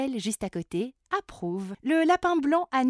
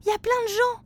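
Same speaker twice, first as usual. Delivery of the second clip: put-on high, loud, excited child; 12 semitones higher, 7 dB louder than usual. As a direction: call out in wonder. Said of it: "s'exclame Flora".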